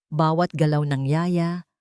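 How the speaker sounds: ordinary delivery, nothing marked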